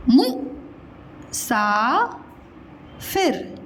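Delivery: Musafir broken by long pauses; syllable by syllable. Shown as a demonstration. Teacher spelling it for children, syllable by syllable. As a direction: sound out Musafir slowly, mu, saa, fir.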